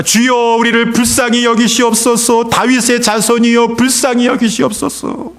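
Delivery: high, sustained pleading cry. Acted. Blind beggars to passing Jesus, crying out for mercy. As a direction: call out for mercy on one high, chanting pitch. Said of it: "막 소리를 지르는 것입니다".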